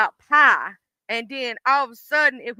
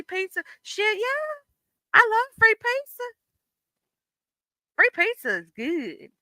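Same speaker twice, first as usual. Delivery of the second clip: excited; 11 semitones higher than usual. Real, not acted. Excited about free pizza.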